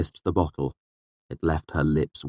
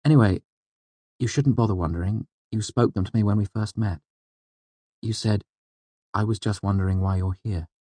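lightly voiced woman; 4 semitones higher than usual. A narrator's reading. Narrator voices Denna recalling their meeting.

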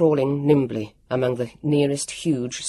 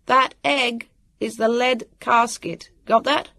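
slow, higher, word-by-word call; deliberate calling, each word separate; careful call; 9 semitones higher, slower than usual. A narrator's reading. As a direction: spell it out so it carries. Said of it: "Jonathan called carefully".

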